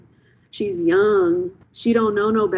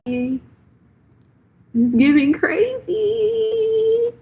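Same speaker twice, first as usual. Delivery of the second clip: "crazy" stretched very slowly, sung much higher; drawn-out sing-song; 13 semitones higher, much slower than usual. Shown as a demonstration. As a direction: draw "It's giving crazy" out in a high teasing sing-song.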